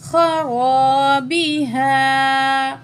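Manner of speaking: खराबी हा held long with a heavy ख; heavy, stretched खराबी हा; drawn-out recitation. Shown as a demonstration. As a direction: recite खराबी हा slowly, letters thick. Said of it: "एक अलिफ के बराबर खींचेंगे".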